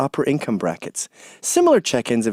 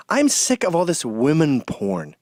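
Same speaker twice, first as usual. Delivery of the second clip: normal talking voice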